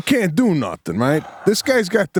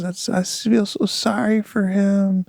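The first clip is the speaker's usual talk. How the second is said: higher, slow imitation of tearful pity; almost teary-eyed; 5 semitones higher, slower than usual. Wife pitying the fallen hiker, mock teary voice. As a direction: mimic her, close to tears with sympathy. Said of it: "almost teary-eyed. She goes".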